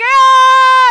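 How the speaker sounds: high loud cry of release; mock worship cry. A worshipper at the altar, high loud cry. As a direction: let out a cathartic shout. Crowd cheering at conference.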